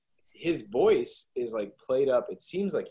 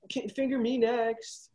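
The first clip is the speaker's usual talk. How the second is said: put-on eager girl voice; 12 semitones higher, slower than usual. Girls eager, voiced high.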